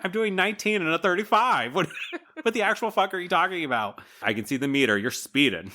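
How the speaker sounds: high-pitched incredulous retort; mock exasperation. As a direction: act out a baffled driver arguing back. Retold exasperated driver argument.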